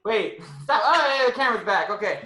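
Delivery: ordinary talk, nothing marked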